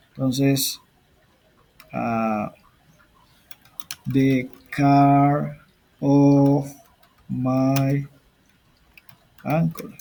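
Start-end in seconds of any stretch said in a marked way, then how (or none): 4.1-10.0 stretched words, a pause after each; slow word-by-word dictation. Shown as a demonstration. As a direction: dictate it slowly, one word at a time. Teacher slowly dictates example while typing.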